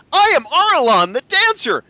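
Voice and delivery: high, loud showy announcement; dancer girl. Acted. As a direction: proudly present herself to the robot. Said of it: "comes up to Warmek and says".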